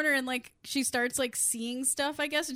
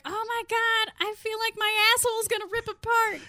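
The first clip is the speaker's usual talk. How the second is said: mock labor pain; 10 semitones higher, 4 dB louder than usual. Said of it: "Becca's just like".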